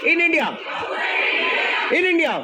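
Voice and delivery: slow, sing-song reading of the clause; drawn-out classroom chant; rote recitation. Shown as a demonstration. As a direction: chant it slowly for the class to repeat.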